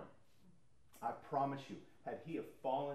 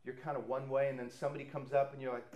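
ordinary talk

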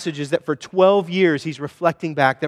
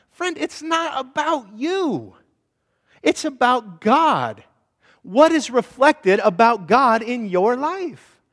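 higher, drawn-out pleading; slow earnest plea; 7 semitones higher, slower than usual. Acted. Preacher pleading with the congregation, slow and earnest. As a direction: stretch each word to land it. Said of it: "preacher pleads directly".

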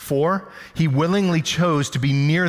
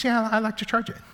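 higher mock wife voice, breezy and unbothered; imitating wife; 8 semitones higher, 3 dB quieter, faster than usual. Pastor mimics his wife defending her cables, mock higher voice. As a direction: play the wife shrugging him off, lightly. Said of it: "She goes".

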